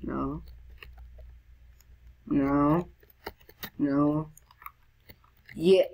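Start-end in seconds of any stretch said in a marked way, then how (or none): none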